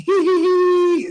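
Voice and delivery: high, loud silly tittering; mocking giggle. Guru mocking his student's ecstatic giggle, high silly titter. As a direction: giggle like a smug self-declared saint. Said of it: "a little, like a Johan thing".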